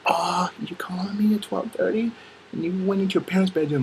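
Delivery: high, whispery put-on girlfriend. Girlfriend answering a twelve-thirty call, high whispery coo.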